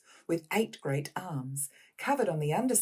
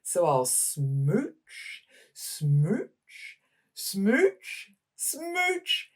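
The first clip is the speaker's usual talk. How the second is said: loud, happy fish voice, smooches climbing much higher; cheerful kiss-kiss fish; 6 semitones higher, 6 dB louder than usual. Acted. Fish to his friends, loud delighted smooching. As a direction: sing out the smooches, rising with joy. Happy fish's new refrain.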